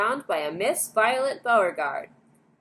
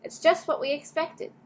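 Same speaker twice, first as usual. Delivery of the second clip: higher, falsely cheerful; brightly; 10 semitones higher than usual. A narrator's reading. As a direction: cover the letdown with cheer. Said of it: "said Grandpa Joe brightly".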